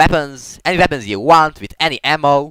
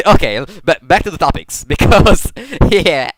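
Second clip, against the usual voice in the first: louder, laughing as they talk; 5 semitones higher, 7 dB louder than usual. Real, not acted. Gamer distracted by gameplay returns to topics, laughing. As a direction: laugh your way through the words.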